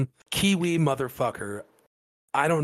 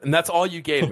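ordinary talk, nothing marked

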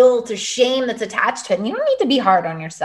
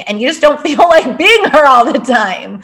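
laughing; 4 semitones higher, 6 dB louder than usual. Real, not acted. Laughing about being "on" all day.

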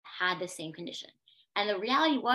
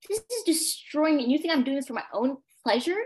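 higher, louder, stung outrage; indignant protest, pitched up; retold indignation; 6 semitones higher, 5 dB louder than usual. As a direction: throw the accusation back at them, voice raised. Retells her outrage at being doubted.

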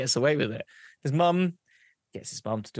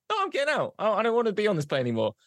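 high-pitched refusal; stubborn protest; 8 semitones higher, much faster than usual. Acted. Bored monkey to the passenger next to him, high stubborn protest. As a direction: insist in a raised, cheeky voice.

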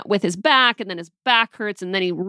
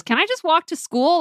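annoyed daughter; 9 semitones higher than usual. Retold or imitated. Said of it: "she's totally annoyed and ready to go".

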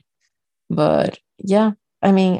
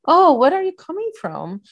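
much higher, bright mock curiosity; high chirpy small-town woman; 10 semitones higher than usual. A woman imitating a small-town lady questioning her, high and chirpy. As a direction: chirp it like a nosy local lady. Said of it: "she asked me, like".

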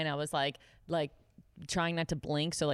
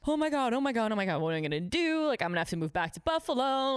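high, quick flustered panicking; retold panic; 3 semitones higher than usual. Waitress terrified after a mistake, acted-out panic. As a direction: spiral into mock terror. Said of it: "you were fucking terrified".

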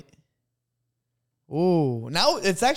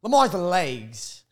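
slow emphatic; 3 dB louder, much slower than usual. Acted. Hyping the pick slowly.

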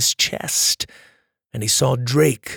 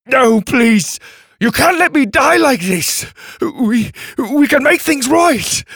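desperate pleading; 11 semitones higher, 6 dB louder than usual. Acted. Brother begs not to die.

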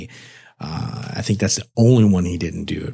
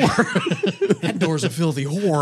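slow, high, laughing drawl; laughing quote; 7 semitones higher, much slower than usual. Retold or imitated. Podcaster quoting a favourite movie line, laughing. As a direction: savour the rude line through laughter.

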